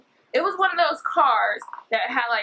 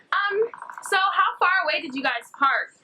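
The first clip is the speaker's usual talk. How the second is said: lightly voiced higher, casual probing question; fake-friendly stranger; 7 semitones higher than usual. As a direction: raise the pitch to mimic the woman. Acting the woman's probing question.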